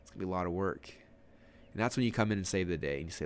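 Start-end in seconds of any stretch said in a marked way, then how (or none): 0.0-0.9 softer, lower, mildly overwhelmed murmur; daunted. Retold or imitated. Audience doubting they can do it, imitated quiet daunted thought. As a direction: voice a sinking doubt, understated. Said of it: "that feeling where it's like".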